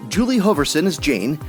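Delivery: ordinary talk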